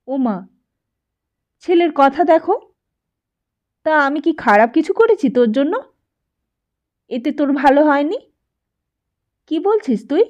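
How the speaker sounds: a mother stung by her son's question; taken aback mother. A narrator's reading.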